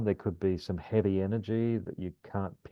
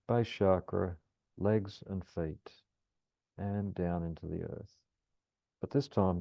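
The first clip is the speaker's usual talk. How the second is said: calm grounding exercise. Acted. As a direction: ease them gently through the breath. Guides a grounding meditation.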